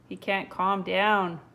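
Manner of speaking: ordinary delivery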